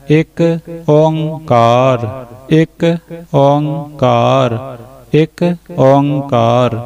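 short ਇੱਕ, then ਓਂਕਾਰ drawn out very slowly; slow model recitation. Shown as a demonstration. Teacher closing with the correct form, slow. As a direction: repeat slowly, stretching Onkar. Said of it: "ਉਚਾਰਨ ਕਰਨਾ ਹੈ".

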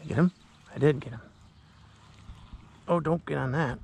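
usual speaking voice

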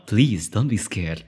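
low, slow, soothing big-sister voice; attempted ara-ara character voice; onesan voice. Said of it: "an Onesan voice".

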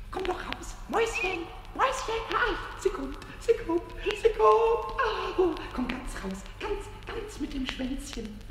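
soft, high, excited luring; hushed coaxing. Acted. Pumuckl lures the mouse from its hole, hushed and eager. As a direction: coax a shy little animal out quietly.

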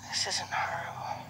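soft, breathy reassurance to the horse; soothing whisper. Real, not acted. Trainer soothing a jumpy mare, gentle whisper. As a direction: whisper her calm, you're okay.

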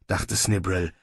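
usual speaking voice

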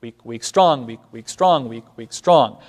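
weak weak then strong with a falling stress; chanted meter pattern; anapestic beat. Shown as a demonstration. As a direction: chant two light beats then a strong one. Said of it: "or anapestic".

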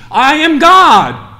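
preacher's booming climax; slow shouted climax. Acted. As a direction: land it word by word like thunder. Climax of I am list.

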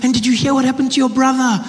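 high, loud, excited mother; eager mom. Retold or imitated. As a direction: imitate mom bursting to share bigger news. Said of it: "And she's like".

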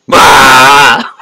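slow build to a loud roar; orc war cry. Acted. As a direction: tilt the glasses and roar. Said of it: "Just so I start charging the orcs".

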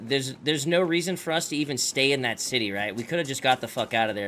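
ordinary talk, nothing marked

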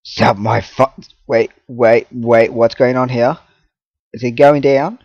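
ordinary delivery

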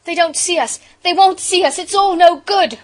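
loud, high cry of alarm; desperate cry. A narrator's reading. Bobby as the train fails to see them, desperate. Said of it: "cried Bobby".

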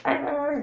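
high-pitched drawn-out dinosaur cry; mock dinosaur roar. Man playing dinosaur at his friends, high mock roar. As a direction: let out a high, playful dinosaur screech. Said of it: "He's like, making dinosaur noise".

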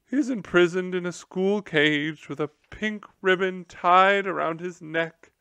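high, loud, theatrical lament; the general imagining newspaper disgrace; distraught general. Acted. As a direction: wail over your ruined reputation.

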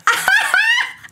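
high squealing burst of laughter; surprised shriek laugh. Real, not acted. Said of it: "you have done your research".

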